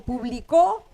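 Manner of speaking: publicó with the force on the final "có"; stress on third syllable. Shown as a demonstration. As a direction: lean on the last syllable of publicó. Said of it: "Entonces la tres, no".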